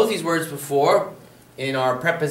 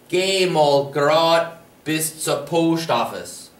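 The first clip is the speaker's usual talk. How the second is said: slow repeat; slower than usual. Shown as a demonstration. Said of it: "For example".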